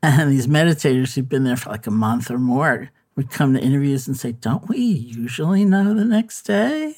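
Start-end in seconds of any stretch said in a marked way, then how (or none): 4.3-6.9 higher, slow puzzled question; puzzled meditator voice. Retold or imitated. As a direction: ask it like a baffled retreatant.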